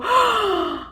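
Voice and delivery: high-pitched theatrical gasp; shocked gasp. Retold or imitated. Niece reacting to aunt's story, high shocked gasp.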